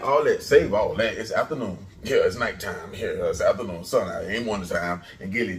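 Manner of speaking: usual speaking voice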